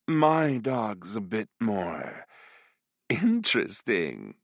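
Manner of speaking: ordinary delivery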